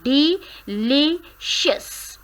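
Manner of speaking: delicious slowly, li stressed; slow stress demo. Shown as a demonstration. Teacher slowly modelling delicious, li stressed. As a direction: draw out delicious, lean on li. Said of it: "Stress is on the second".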